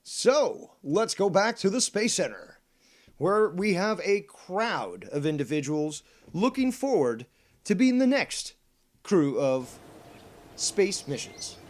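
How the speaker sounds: high, loud hyped announcer; excited announcer. Acted. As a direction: gush like a cheery TV host. Upbeat news-style segue.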